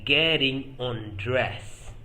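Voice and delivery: loud, drawn-out teaching delivery; slow and firm, word by word; slow, emphatic modelling. Shown as a demonstration. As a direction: stretch each word out for learners. Teacher models the two contrasting phrases.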